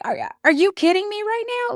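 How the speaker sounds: retold stunned protest in a high voice; incredulous. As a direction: play your own disbelief high and quick. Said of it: "I'm sitting there like".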